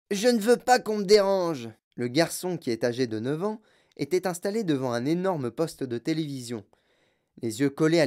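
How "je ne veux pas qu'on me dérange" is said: put-on high, loud furious boy; angry boy. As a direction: snap at the intruders. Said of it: "d'une voix courroucée".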